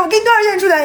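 high, animated mock demand; mock haggling. Customer offering the hostess money, mock insistence. Retelling a bickering standoff.

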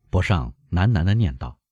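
usual speaking voice

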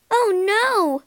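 high, dismayed outcry; distressed cry. A narrator's reading. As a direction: cry out as a distraught young heroine. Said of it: "cried Beauty".

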